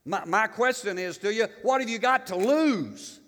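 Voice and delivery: loud, fired-up preaching at full force; loud revival-style preaching. Acted. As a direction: preach it out to the congregation, driving each line.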